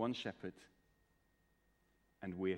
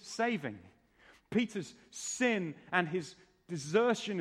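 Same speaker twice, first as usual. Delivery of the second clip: louder, higher, emphatic pulpit delivery; impassioned preaching; 5 semitones higher, 4 dB louder than usual. Real, not acted. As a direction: drive the point home to the congregation. Preacher drives home his main point.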